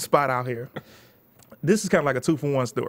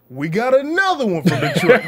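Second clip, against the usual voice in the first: louder, higher teasing emcee; host mocking intro; 7 semitones higher, 7 dB louder than usual. Host introducing the next Detroit comic, loud and laughing. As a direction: announce him with a laugh. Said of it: "Lil Duval comes up".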